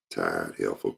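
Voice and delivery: low, soft catty mutter; snide aside. Retold or imitated. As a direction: drop it under your breath behind her back. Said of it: "That's the first thing they'll do".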